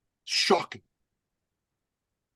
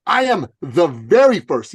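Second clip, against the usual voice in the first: stunned disbelief; 4 semitones higher, 8 dB louder, slower than usual. Retold or imitated. Stunned repeating coach.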